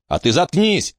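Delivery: резко, выше обычного, но без громкости; осади её одной фразой; рявкнула. A narrator's reading. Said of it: "рявкнула Эмма". (лёгкий окрик)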